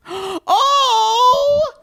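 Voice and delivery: swooning squeal. Pretend. Fan swooning over celebrity name.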